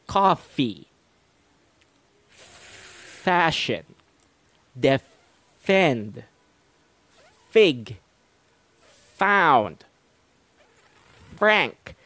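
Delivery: careful F demo, word by word; clear F sounds. Shown as a demonstration. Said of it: "I did not connect my lips together when making the F sound".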